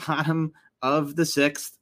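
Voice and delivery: ordinary talk, nothing marked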